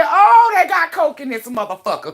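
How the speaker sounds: recalling the sting of the soda with a jolt; retold startled cry. Telling friends about burning soda, a startled high yelp.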